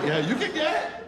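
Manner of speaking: very high, taunting come-on; teasing zebra. Acted. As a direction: call the zebra over, mocking. Flirting with the zebra, acted.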